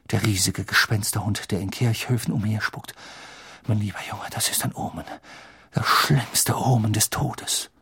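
hushed doom-laden voice, sinking into breathiness; ominous Trelawney. Acted. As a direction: intone the omen gravely, half whispered at the end. Trelawney pronounces the death omen. (Trelawney, unheilvoll gedämpft und hauchend)

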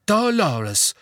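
high, firm address; resolute Fudge. A narrator's reading. Fudge addresses Umbridge to settle the matter, resolute. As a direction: call the name decisively, falling. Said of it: "mit der Miene eines Mannes".

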